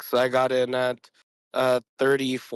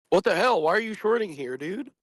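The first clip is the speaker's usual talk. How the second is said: mock disbelief; 6 semitones higher than usual. Retold outrage of other traders.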